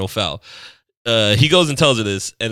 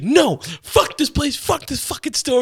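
high-pitched outraged customer, imitated; mock yelling; 12 semitones higher, slower than usual. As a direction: throw a retold tantrum, pitched way up. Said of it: "yelling as she's leaving".

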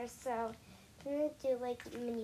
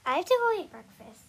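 louder, urgent announcement; 6 semitones higher, 13 dB louder than usual. Real, not acted. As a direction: tell them quickly and loudly. Talking loudly over interruption.

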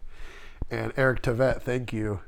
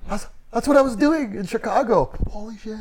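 high, loud, astonished realization; excited realization; 10 semitones higher, 5 dB louder than usual. Retold or imitated. Watching The Secret and realizing he did it already, astonished. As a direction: blurt it out amazed, then drop to a stunned hush.

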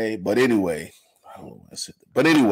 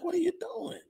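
mock squeaky disbelief, much higher and softer; incredulous quote; 7 dB quieter than usual. Imitating himself asking the bishop, a mock high squeak. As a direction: throw up your hands in disbelief at him. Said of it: "I'm like".

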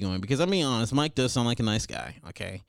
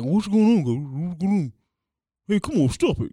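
higher, louder flustered guy; Mike voice; 7 semitones higher, 6 dB louder than usual. Retold or imitated. Mike pleading with fighting mother and daughter, loud high. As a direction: panicky bystander trying to stop fight. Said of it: "Mike's sitting there just like".

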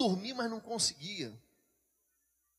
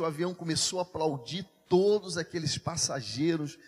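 normal talking voice

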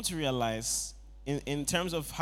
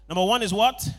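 calling out; 4 semitones higher, 9 dB louder than usual. Acted. Preacher calls out to congregation.

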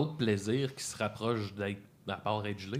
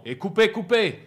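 raised voice, climbing on the repeat; second word higher and louder; retold director's call; 6 semitones higher, 6 dB louder than usual. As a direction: mimic a director calling cut. Retelling director calling cut.

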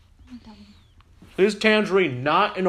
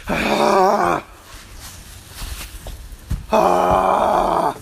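startled yell; 5 semitones higher, 5 dB louder than usual. Real, not acted. Tangerine squirting, startled.

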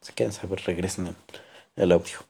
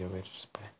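whispered aside; whispering; 13 dB quieter, faster than usual. Real, not acted. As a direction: whisper it to yourself. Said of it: "me llegó una noti".

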